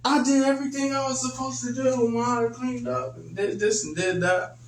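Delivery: put-on high, whiny kid pleading his case; high-pitched child insisting he did it all; child's voice. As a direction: play the kid defending himself, way up high. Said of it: "the kid's running up like".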